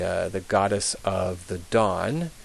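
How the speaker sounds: ordinary delivery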